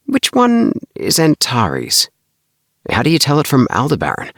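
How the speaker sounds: high, wide-eyed asking voice; mock-naive schoolboy asking about stars; innocent question. A narrator's reading. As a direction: fake innocence to set a trap. Tommy tests Bart innocently.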